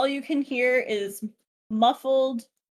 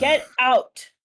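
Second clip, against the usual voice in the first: angry king; 3 semitones higher, 6 dB louder than usual. Acted. King orders hobgoblin out.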